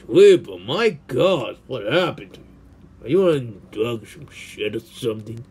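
ordinary talk, nothing marked

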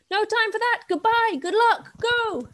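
high, loud parting shouts; shouting. A narrator's reading. Sisters and squirrel sending Luca off, shouting. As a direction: shout them off in a hurry.

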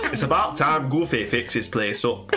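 put-on slow, failed Scottish accent drifting Northern; bad Scottish accent. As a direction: attempt Scottish and slide into Northern English. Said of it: "can you do this in a Scottish accent".

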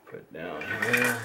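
ordinary talk

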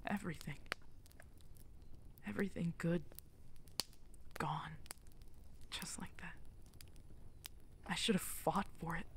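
soft, near-whispered despair; hushed grief. Acted. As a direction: let it drain out to a whisper. Quiet grief after outburst.

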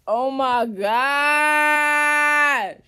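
a long, loud drawn-out wail of defeat; stretched loud groan. Real, not acted. Caught by her sister, loud stretched wail of defeat.

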